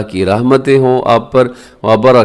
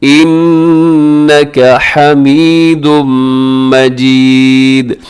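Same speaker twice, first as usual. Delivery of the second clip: slow, higher melodic durood chant; measured tajweed recitation; 3 semitones higher, much slower than usual. Shown as a demonstration. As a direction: chant it slowly with long held vowels.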